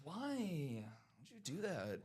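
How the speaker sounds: soft, plaintive plea; quietly lamenting, drawn-out why; mock despair. Host asking Lamar why after interception, quiet mock despair.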